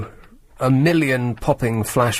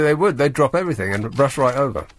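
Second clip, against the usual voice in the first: ordinary delivery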